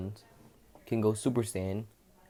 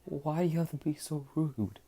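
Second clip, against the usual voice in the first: whiny hurt protest; 8 semitones higher than usual. Acted. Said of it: "Midoriya says".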